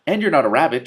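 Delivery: high, loud catty retort. Acted. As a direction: point it out in a high, bright cat voice. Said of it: "Trouble pointed out".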